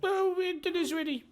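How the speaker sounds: very high, squeaky flattery; grovelling goblin. Acted. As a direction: fawn over the orc in a squeak. Goblin answers.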